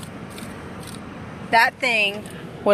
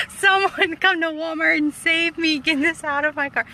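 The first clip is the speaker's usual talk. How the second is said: higher, strained plea for help after a teary laugh; panicked plea; 8 semitones higher than usual. Real, not acted. Stuck in her car with a bug, begging anyone to save her. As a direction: beg to be rescued, half laughing.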